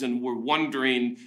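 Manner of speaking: ordinary talk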